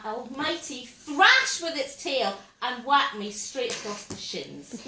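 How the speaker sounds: usual speaking voice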